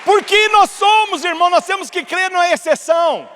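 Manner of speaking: high, loud, slow sermon climax; telling the congregation to believe in the exception, full force; preaching at full force. Acted. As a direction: proclaim it to the church.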